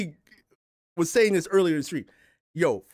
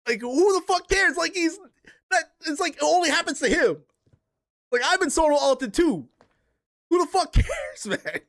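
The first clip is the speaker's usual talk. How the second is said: incredulous rant; 8 semitones higher than usual. Real, not acted. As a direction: vent in disbelief, voice climbing high. Ranting about a whining teammate.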